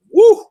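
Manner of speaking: high, loud whoop of disbelief; whooping woo. Real, not acted. Host whooping at the castor oil story, loud and high. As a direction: let out a big whoo.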